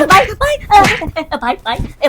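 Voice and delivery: high, loud cry of brother brother; high loud plea to the angry don; loud pleading call. Acted. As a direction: call out desperately to calm him.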